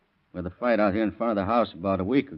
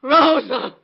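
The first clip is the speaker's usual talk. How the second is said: loud high shouting of names; frantic calling; 8 dB louder, slower than usual. Acted. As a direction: call out desperately through the house. Calling out for his wife.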